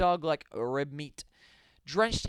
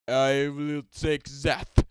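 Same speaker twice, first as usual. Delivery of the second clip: low, slow, loud action-hero impression; Arnold Schwarzenegger; 4 semitones lower, 6 dB louder, slower than usual. A narrator's reading. Action star seizing the Jellifier, hammed-up impression. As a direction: stretch the words out like a heavy-accented strongman. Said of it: "says the illustrious Arnold Schwarzenegger".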